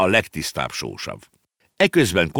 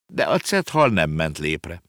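plain reading voice

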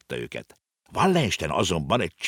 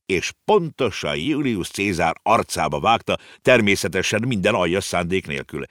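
plain reading voice

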